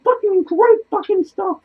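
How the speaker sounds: ordinary delivery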